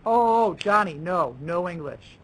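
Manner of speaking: overdone teacherly telling-off, starting loud; mock scolding. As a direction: pounce on the student with exaggerated alarm. Mimics a teacher scolding a student.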